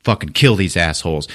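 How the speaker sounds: ordinary talk, nothing marked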